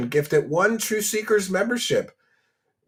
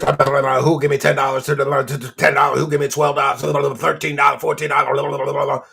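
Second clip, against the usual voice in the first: fast, loud auctioneer patter; 3 dB louder than usual. Retold or imitated. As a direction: rattle off bids like an auctioneer. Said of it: "He sounds like, you ever go to an auction, those auctioneers?".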